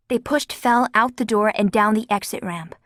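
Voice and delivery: usual speaking voice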